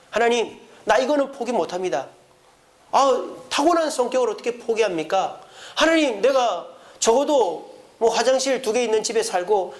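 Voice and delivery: a mildly acted petulant prayer; whining plea to God. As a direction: press God for a bigger house and car. Congregant haggling with God.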